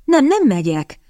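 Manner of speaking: stubborn. A narrator's reading. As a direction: dig your heels in. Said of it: "makacsolta meg magát a lány".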